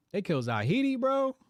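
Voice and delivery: bro shot up high and loud; real shock breaking through; shocked exclaim. Real, not acted. Reacting to assassination news.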